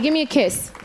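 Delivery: ordinary delivery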